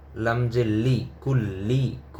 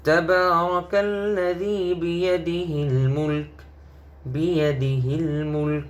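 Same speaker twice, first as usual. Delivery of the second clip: higher chant ending মুলক with no vowel; melodic recitation with stop; 6 semitones higher than usual. Shown as a demonstration. Teacher chanting the verse with the pause form. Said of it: "পেশের উচ্চারণ হবে না".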